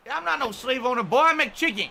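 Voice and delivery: high-pitched Southern protest; terrible southern accent. Acted. Costumed streamer denying it to chat, high hammed-up Southern accent. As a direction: protest like an indignant bad Southern colonel. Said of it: "this terrible southern accent".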